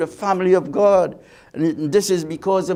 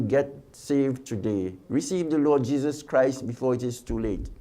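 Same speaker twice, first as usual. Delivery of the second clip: earnest plea; 6 semitones lower, 4 dB quieter than usual. Real, not acted. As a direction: plead gently, drawing it out. Preacher's closing plea.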